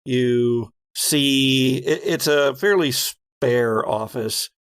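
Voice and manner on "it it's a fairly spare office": ordinary delivery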